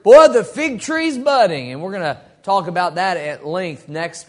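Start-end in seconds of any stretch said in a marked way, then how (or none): 0.0-1.7 higher, louder, slow proclamation; preacher crying wolf over world events; alarmist preacher. Retold or imitated. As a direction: proclaim it with mock solemn urgency. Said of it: "Or you've heard".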